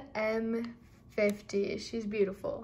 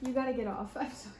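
usual speaking voice

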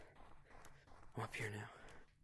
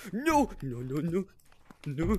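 rising, louder string of no's; panicked protest; 5 semitones higher, 9 dB louder, slower than usual. Real, not acted. Caught by a player in the game, panicked protest. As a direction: plead no, no, no with growing alarm.

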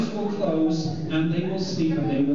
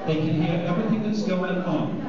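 usual speaking voice

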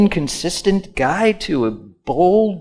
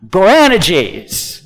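Boanerges with the AN punched hard; stress on right syllable; 4 dB louder than usual. Shown as a demonstration. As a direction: lean on the second syllable of Boanerges, then fall. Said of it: "the emphasis on the right syllable".

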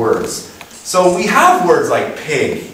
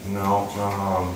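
ordinary talk, nothing marked

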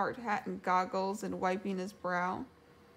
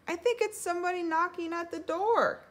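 high-pitched little character voice; small, high, anxious voice; nervous Piglet; 10 semitones higher, 3 dB louder than usual. A narrator's reading. Piglet hearing knocking at the door, nervous.

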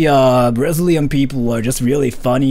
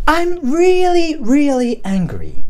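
high, slow, insistent Karen complaint; demanding voice; 10 semitones higher, slower than usual. Shown as a demonstration. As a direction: whine and demand, pitched high. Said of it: "in a demanding voice?".

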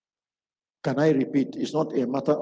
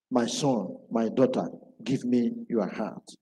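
low, slow tender call; tender plea; 6 semitones lower than usual. Acted. Preacher voicing God calling his children tenderly. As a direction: plead gently as a father.

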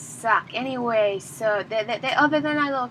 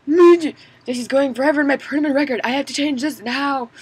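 mock panic; 4 semitones higher, 6 dB louder than usual. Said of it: "I'm like".

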